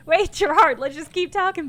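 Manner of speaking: high, loud, panicked plea. Real, not acted. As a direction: beg him to stay on.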